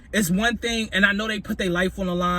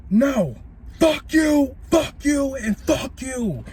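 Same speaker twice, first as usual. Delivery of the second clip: shouting at the cops; 8 semitones higher, much slower than usual. Retold or imitated. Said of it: "I'm like".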